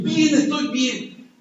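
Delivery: cheerful healed man. Retold or imitated. Healed mechanic answers cheerfully.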